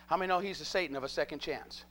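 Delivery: normal talking voice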